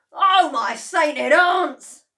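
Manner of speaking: loud, high, slow dismay; dismayed cry. A narrator's reading. Mister Cranky at the stilted chicken, loud. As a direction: wail in dismay. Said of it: "cried Mister Killy Cranky".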